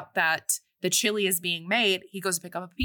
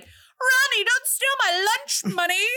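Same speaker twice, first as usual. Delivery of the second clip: much higher and louder, whining to the teacher; whiny tattletale; 4 dB louder than usual. Retold or imitated. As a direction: whine it out like a kid telling on a bully. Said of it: "He's like".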